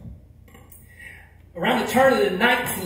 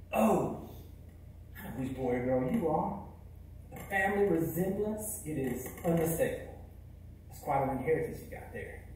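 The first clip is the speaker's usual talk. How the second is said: softer, low and tender blessing; gentle reassurance; 3 semitones lower, 11 dB quieter than usual. Acted. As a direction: comfort the ashamed boy softly. Speaker repeats the blessing to audience.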